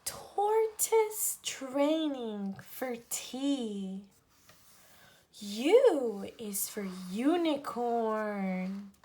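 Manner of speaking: lively picture-book read-aloud, each letter set apart; reading to children. Acted. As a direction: read an alphabet book to little kids. Reads alphabet book to children.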